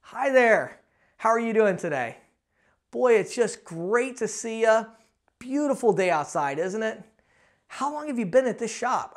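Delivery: gentle, unhurried greeting with pauses; calm and unrushed, matching an old man; slowed down. Shown as a demonstration. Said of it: "I'm slowing down".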